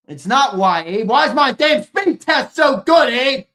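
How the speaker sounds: loud, high, fed-up yelling at chat; exasperated shout. Real, not acted. Streamer yelling at his chat about the speed test, exasperated shout. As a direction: blast it out in mock outrage.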